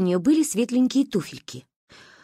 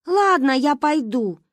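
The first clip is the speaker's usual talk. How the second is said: gracious; 6 semitones higher, 4 dB louder, slower than usual. Acted. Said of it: "вымолвила милостиво".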